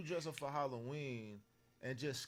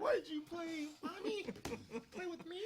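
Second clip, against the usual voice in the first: put-on high child voice. Boy's scared voice retold.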